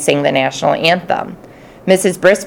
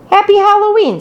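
cheerful greeting; 14 semitones higher than usual. Acted. Cheerful greeting entering classroom.

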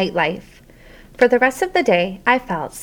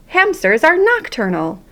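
lightly voiced higher hamster squeak; 8 semitones higher than usual. A narrator's reading. Hamster corrects the class, lightly squeaked and higher. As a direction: pipe up as a little hamster. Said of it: "I squeaked".

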